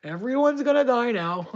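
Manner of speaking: high, loud threat, hammed up; mock breaking point. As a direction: act out finally losing it. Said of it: "push me to the edge".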